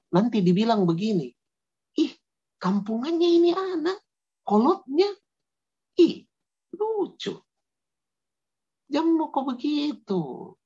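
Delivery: high, slow mocking Makassar accent. Teasing friends mocking a shy kid, high Makassar accent. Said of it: "ini pakai logat Makassar".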